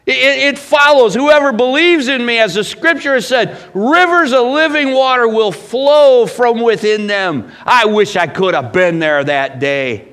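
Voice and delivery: high, loud, ringing scripture proclamation; impassioned preaching. Acted. Preacher reads the promise of living water, in impassioned full-force preaching.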